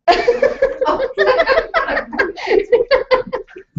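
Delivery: high, bright burst of laughter; delighted laugh. Real, not acted. Lecturer laughing at the grass table story, high and delighted.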